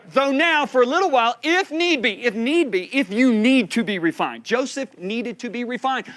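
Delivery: high, loud, emphatic scripture proclamation; full-force preaching. Real, not acted. Preacher reading Peter's words to the church, full-force preaching. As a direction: punch each phrase out to the congregation.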